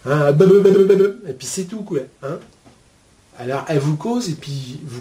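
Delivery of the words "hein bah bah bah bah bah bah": put-on loud, babbling like a baby who can't talk yet; baby babble. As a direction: mimic a baby's bah bah babble, loudly. Royal child who cannot talk yet. (babillage de bébé imité, fort)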